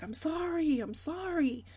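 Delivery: put-on high, pleading little-boy voice; mock sheepish child saying sorry; son's sorry apology. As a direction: plead like a guilty kid. Son apologizing as he gets off the bus.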